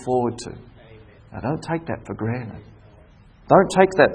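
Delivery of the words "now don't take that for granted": soft warning. Acted. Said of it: "repeated with emphasis".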